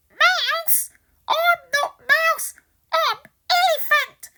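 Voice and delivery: very high, loud, odd squeaky elephant. Acted. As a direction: play a funny trumpeting elephant. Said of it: "says Elephant".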